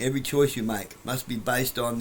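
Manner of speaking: usual speaking voice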